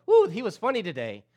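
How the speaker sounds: mock amused churchgoer. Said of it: "I don't want you to leave here going".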